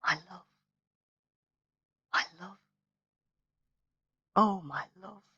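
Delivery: soft, hushed lament over her body; whispered. A narrator's reading. Son kneeling by his dead mother, softly whispering. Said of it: "he whispered".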